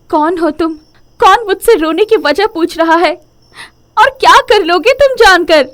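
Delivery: high, upset acted cry; anguished and defensive, high-pitched; distraught challenge. Weeping woman challenges unknown voice.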